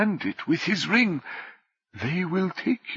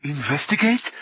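slightly hysterical; 3 semitones lower, 3 dB louder than usual. A narrator's reading. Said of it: "had a slightly hysterical sound".

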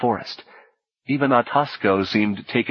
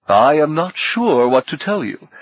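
raised, higher host's voice; addressing everyone, pitched up; said aloud; 6 semitones higher, 4 dB louder than usual. A narrator's reading. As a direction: announce it aloud to the room. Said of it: "he said aloud".

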